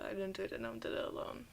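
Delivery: low, soft murmur trailing into a whisper; muttered under breath. Real, not acted. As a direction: mumble it to yourself while puzzling. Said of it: "rereads clue under breath".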